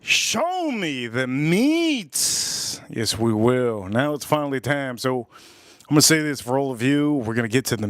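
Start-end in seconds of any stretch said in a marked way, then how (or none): 0.0-2.8 very slow, higher drawn-out delivery; lightly voiced April, drawn out; quoting April. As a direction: stretch every word out slowly, a bit higher. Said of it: "April says".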